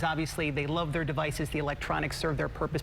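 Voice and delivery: ordinary delivery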